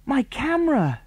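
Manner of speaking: high-pitched wail of dismay; horrified. A narrator's reading. Tom to the others, horrified high wail. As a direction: cry it out in horror. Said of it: "his face a picture of horror".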